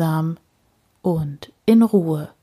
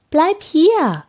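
high, louder call to the cat; 10 semitones higher, 4 dB louder than usual. A narrator's reading. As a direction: call up after the cat, pleading. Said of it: "sagt Frau Usebuse".

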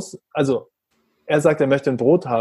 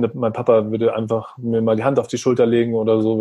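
ordinary talk, nothing marked

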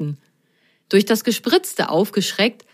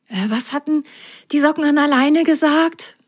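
feverish thinking; 8 semitones higher than usual. Acted. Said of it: "Überlegte Rosenrot fieberhaft".

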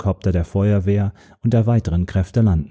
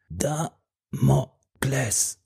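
very soft, slow, breathed-out word; dying whisper; 5 semitones higher, 7 dB quieter, much slower than usual. A narrator's reading. Dying man whispering to the narrator, very soft and slow. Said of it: "flüsterte der Mann".

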